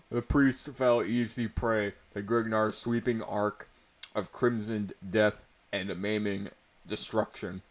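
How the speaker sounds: ordinary delivery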